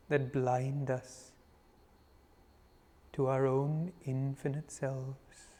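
unhurried, reverent meditation guide; guided meditation invocation. Real, not acted. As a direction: lead them gently into meditation, phrase by phrase.

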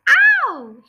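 sudden high, loud yelp of pain; pained scream. Acted. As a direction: yell "Ow!" as the glue rips. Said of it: "he screamed".

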